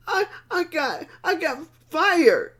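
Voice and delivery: retold tearful confession, voice high and breaking; imitating a friend crying on the phone. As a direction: let the words crack through sobs. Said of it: "All I could hear was this crying".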